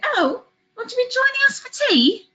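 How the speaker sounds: high, lilting mock-British hostess; terrible British accent. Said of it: "for that terrible accent".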